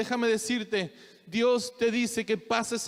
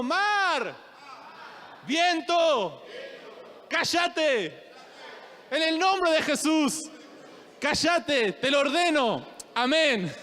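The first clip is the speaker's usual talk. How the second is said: shouted command; 5 semitones higher, much slower than usual. Shown as a demonstration. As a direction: shout it out with full authority. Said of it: "Decí conmigo".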